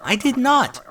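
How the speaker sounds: sharp high indignant interruption; interrupting. A narrator's reading. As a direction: blurt it out over the teacher. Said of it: "interrupted Dinky".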